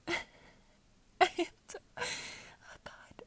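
helpless laughter breaking up the words; laughing to tears. Real, not acted. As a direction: barely get it out through real laughter. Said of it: "I'm, like, fucking crying".

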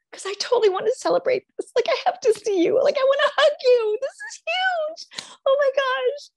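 high, gasping disbelief and delight; squealing, astonished joy; overjoyed celebration. Acted. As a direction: gush as if thrilled for a friend. Acting out celebrating a friend's news.

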